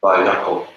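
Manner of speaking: bayako said slowly and carefully; careful word demo. Shown as a demonstration. Teacher models bayako for learners, carefully. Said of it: "Look at the word".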